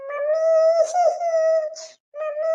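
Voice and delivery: high wailing call for mummy; crying child. Acted. Fallen child wailing for mummy, crying. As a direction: cry out high, drawing out the word. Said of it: "ಅಳ್ಬೇಡ".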